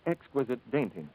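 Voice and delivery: ordinary delivery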